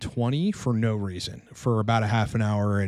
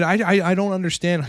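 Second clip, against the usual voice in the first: put-on Italian accent; 7 semitones higher than usual. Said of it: "He said in his Italian voice".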